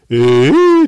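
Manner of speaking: a loud indignant "えー"; mock angry outburst. [name] protesting the silly preview, loud mock indignation. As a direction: blurt it out, loud and put out. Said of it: "マサさんも怒ってん".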